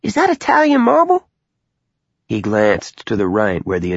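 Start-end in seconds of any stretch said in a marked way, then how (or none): 0.0-1.3 mother voice. Acted. Mother's voice in narration.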